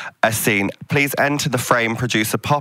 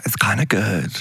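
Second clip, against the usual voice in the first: whisper; 7 semitones lower than usual. Real, not acted. Confessing a guilty pleasure.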